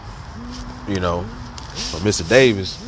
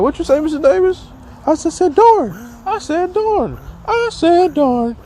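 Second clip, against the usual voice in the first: hammed-up high squeaky voice, much higher and louder; mock teacher; 3 dB louder than usual. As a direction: squeal an innocent cover-up. Imitating teacher covering cuss word.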